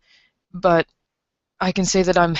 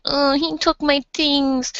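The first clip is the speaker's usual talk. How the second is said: slow, higher, put-on whiny voice; mock whimper; 8 semitones higher, slower than usual. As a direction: imitate a helpless victim whimpering. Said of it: "sitting on the street and crying, going".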